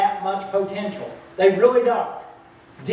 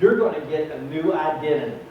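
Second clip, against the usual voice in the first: ordinary talk, nothing marked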